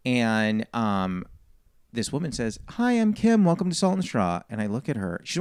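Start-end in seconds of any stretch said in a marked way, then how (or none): 2.7-4.4 much higher, lightly imitated welcome; cheerful greeter. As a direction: chirp a shop greeting in a raised voice. Voicing the founder's greeting in story.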